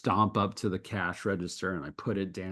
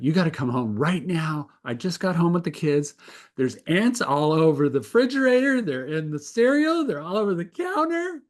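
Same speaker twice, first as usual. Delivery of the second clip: imitating his panicked wife, high and loud; mock panic, rising to a squeal; 6 semitones higher, 4 dB louder than usual. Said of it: "she was in a panic".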